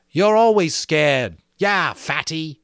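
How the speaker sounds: loud, slow sneer; taunting Jack. A narrator's reading. Jack to Piggy, sneering taunt. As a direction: jeer at him with contempt.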